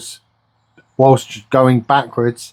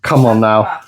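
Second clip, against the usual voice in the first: ordinary talk, nothing marked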